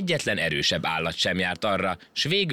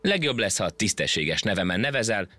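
usual speaking voice

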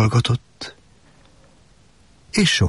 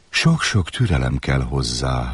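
lower, calm and patient; 6 semitones lower than usual. Acted. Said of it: "felelte a róka". (a róka mélyebben, türelmesen feleli)

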